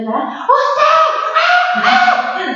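very high mock screaming; shrill wordless outburst in mimicry; retold yelling. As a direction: imitate mom starting to yell. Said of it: "me empezó a gritar".

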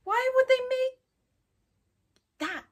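very high, drawn-out dismay; scared disbelief. Real, not acted. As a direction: squeak it out slowly in alarm. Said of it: "like, scared".

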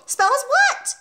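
put-on very high child's squeal; little boy's squealed answer. A narrator's reading. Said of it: "he squealed".

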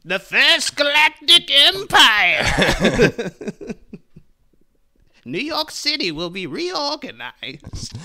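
high, slow, stretched Emperor voice; mock Palpatine. Mock Palpatine voice about the film.

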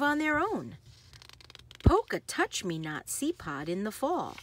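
lively, sing-song picture-book read; bouncy storybook narration with playful sound words; reading to children. Acted. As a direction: read it like bedtime story time for little kids. Reads picture book aloud.

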